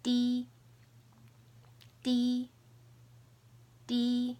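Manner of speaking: "D" as "dee", high, level and held; first tone. Shown as a demonstration. Teacher drilling while learner tries, high level "dee". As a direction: hold each "D" on one steady high pitch. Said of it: "So the four tones are".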